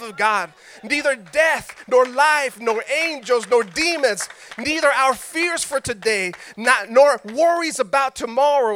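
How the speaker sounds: preaching the passage at full voice; fervent scripture reading. Acted. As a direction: proclaim the verses, building. Reads scripture with rising force.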